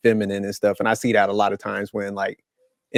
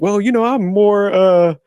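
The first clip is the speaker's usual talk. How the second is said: high, loud, puffed-up mock brag; sing-song mock boast of being nurturing; mocking a boastful guy; 11 semitones higher, 5 dB louder, slower than usual. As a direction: lampoon a guy selling himself as the nurturing one. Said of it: "You're not swiping other guys that don't have kids like".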